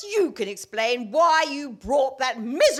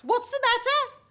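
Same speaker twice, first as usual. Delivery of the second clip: mocking his question; 10 semitones higher than usual. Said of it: "and he asks".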